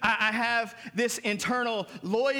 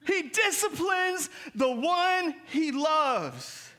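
slow, stretched, high declaration; solemn slow preaching; slow proclamation; 5 semitones higher, much slower than usual. Acted. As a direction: proclaim it over the room. Declared slowly.

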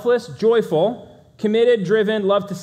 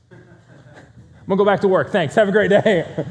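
dismissive brush-off; 4 semitones higher, 6 dB louder than usual. Retold or imitated. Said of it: "there's emotion".

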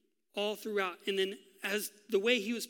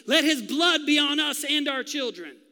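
loud crowd in unison, higher; 7 semitones higher, 10 dB louder than usual. Acted. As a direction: call out together as a mob. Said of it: "the crowd says in unison".

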